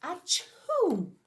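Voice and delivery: big hammed-up sneeze, high and loud; loud fake sneeze. Teacher acting the bear's sneeze for the class. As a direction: burst out with a comic sneeze.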